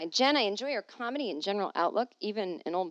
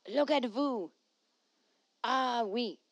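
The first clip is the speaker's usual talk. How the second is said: mock French accent; 3 semitones higher, slower than usual.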